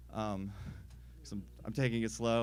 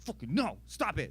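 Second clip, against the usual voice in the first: mock scolding; 3 semitones higher than usual. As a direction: scold the dog, lightly hammed-up. Acting out scolding a dog.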